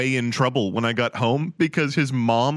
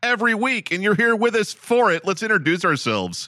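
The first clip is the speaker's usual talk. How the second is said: high, bright host intro voice; announcer; 6 semitones higher than usual. Acted. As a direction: open the show like a host.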